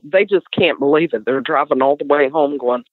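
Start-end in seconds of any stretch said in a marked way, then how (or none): none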